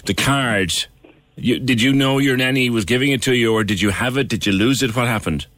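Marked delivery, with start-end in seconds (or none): none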